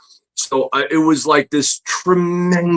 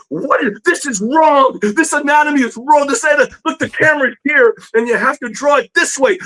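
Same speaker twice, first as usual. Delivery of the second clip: much higher, heated scolding; angry teacher correction; 13 semitones higher than usual. Retold or imitated. Teacher correcting anatomy in front of the class, heated scolding. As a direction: lecture the student in a fury, point by point. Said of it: "And he's like".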